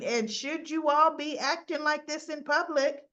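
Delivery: scolding. Retold or imitated. Summer scolds arguing women.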